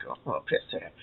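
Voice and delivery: very quiet, low, voiceless echo; muted mock retort. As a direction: mutter the insult back under your breath. [name]'s retort to the insult.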